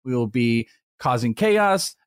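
normal talking voice

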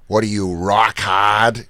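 slow Boston demo with broad ahs; Boston accent. Shown as a demonstration. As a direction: lean on the Boston vowels, dropping the Rs. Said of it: "So, Boston accent, it's just ahs".

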